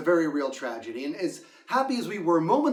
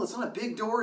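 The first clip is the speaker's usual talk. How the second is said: ordinary talk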